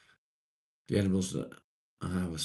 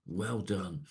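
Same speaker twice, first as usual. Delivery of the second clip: ordinary talk, nothing marked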